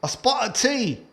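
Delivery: a light put-on British accent; mock British accent. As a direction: play a cheery Brit offering tea. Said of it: "How was that?".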